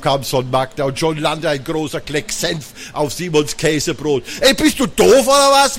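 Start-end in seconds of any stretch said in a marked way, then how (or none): 4.4-5.8 put-on high, loud indignant kid's yell. A narrator's reading. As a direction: bellow at your sister in outrage. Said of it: "brüllte Simon".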